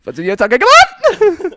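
put-on high, loud German-accented outburst; indignant mock-German shout. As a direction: burst out in a comic German accent. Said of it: "That just sounded like a normal German".

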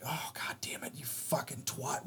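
seething whisper under the breath; whispered curse. Retold or imitated. Mother whispering a curse at the girl who took the seat. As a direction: hiss the insult so nobody hears. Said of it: "I was just like".

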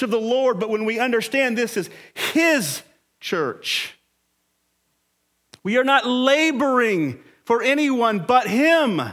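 loud, high, driving sermon voice; preaching at full force. Acted. Pastor drives home Christ owns the church, preaching hard.

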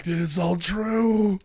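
slow, high-pitched amazed wail; mock awestruck. Finding the drug warnings real, high drawn-out mock awe. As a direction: draw it out in comic disbelief. Retold shock at childhood warnings proving true.

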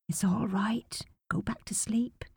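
put-on soft whisper to a sleeping child; hushed, soothing whisper. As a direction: whisper him back to sleep. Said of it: "she whispered to him".

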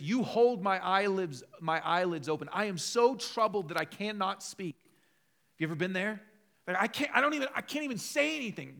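loud, high, impassioned preaching; fervent preaching. Acted. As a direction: press the point home to the congregation. Reading psalm fervently.

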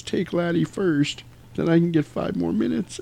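half-asleep murmur; sleepy mumble. Retold or imitated. Sleepy girl quoted.

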